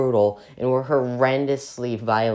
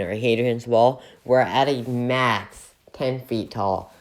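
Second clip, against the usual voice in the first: ordinary talk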